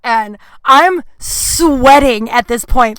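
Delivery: usual speaking voice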